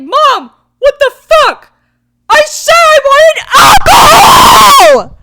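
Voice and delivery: high, very loud, slow screaming complaint; hammed-up indignant kid shout; outraged kid yell. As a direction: scream it at mom in mock fury. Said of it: "It's like".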